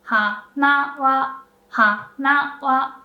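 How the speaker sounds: "hana wa" low on ha, high on na and wa; heiban pitch. Shown as a demonstration. Teacher demonstrating heiban pitch on hana wa. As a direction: say "hana wa" rising to a flat high particle. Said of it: "low, high, and then the particle is high".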